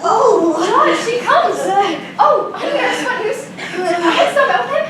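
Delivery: high, laughing eager greeting; excited laughing. Acted. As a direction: bubble over with joy. Excited as nurse arrives.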